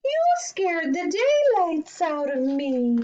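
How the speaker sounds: put-on high-pitched spooked old woman; startled cry. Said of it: "cried the tiny old woman".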